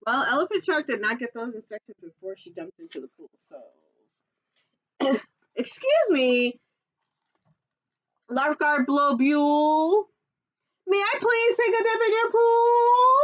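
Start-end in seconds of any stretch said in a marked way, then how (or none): 5.6-13.2 much higher, louder asking voice; Octorino voice. Acted. Octorino asks the lifeguard, high and eager. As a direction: ask sweetly, high.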